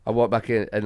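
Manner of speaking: usual speaking voice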